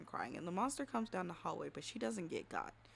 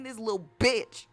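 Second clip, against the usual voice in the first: much louder, seething outburst; enraged; 14 dB louder than usual. Retold or imitated. Storyteller curses the little girl close to the microphone, enraged. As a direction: blurt it with exasperated rage. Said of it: "so you can hear the enrage in my soul".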